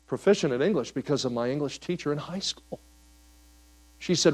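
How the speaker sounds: normal talking voice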